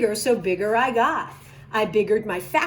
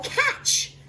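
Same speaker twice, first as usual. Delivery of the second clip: called out; 14 semitones higher than usual. A narrator's reading. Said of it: "calls the Onceler".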